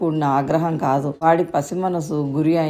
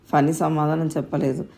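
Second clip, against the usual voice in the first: ordinary delivery, nothing marked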